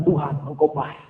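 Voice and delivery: low, soft prayer to God; quiet declaration. Acted. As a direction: say it humbly, low and hushed. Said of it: "Tapi duduk katakan". (doa pelan bernada rendah)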